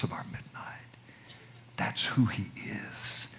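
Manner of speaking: hushed, breathy whisper of awe. Acted. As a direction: drop to a whisper, as if telling a secret. Hushed climax of sermon.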